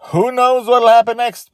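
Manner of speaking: very high, loud cliffhanger; excited teaser. Real, not acted. Host teasing listeners, very high and loud. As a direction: hype tomorrow's episode.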